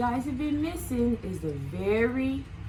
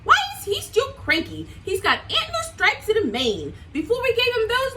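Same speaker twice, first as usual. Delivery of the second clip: much higher, louder puzzled voice; puzzled Lion; 10 semitones higher, 3 dB louder than usual. Acted. Lion to friends, puzzled and high. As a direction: wonder aloud in a lively lion voice. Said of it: "Lion said".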